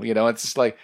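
normal talking voice

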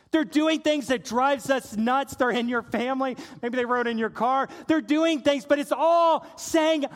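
fervent sermon voice; impassioned preaching. Real, not acted. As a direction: preach it with building force. Preaches at full force.

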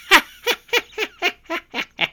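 high hammed-up villain cackle; Joker laugh. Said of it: "some impersonation".

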